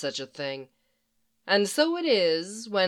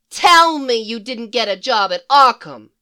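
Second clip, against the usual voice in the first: much louder, shocked cry; horrified exclamation; 3 semitones higher, 10 dB louder than usual. Acted. Harley hearing he works with supervillains, much louder and aghast. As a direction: gasp it out in horror. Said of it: "she exclaims, horrified".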